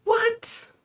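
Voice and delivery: high startled what; shocked squeak; surprised. Real, not acted. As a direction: shoot the word up in disbelief. Reacting to chat news.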